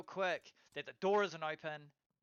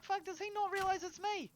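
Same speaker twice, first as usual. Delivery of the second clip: retold thought, pitched way up in disbelief; incredulous squeak. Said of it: "I'm like".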